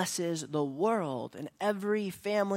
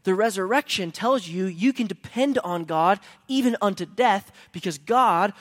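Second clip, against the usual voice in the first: louder closing exhortation; preaching; 3 semitones higher, 5 dB louder than usual. Acted. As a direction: press the application on the church. Closing application preached loudly.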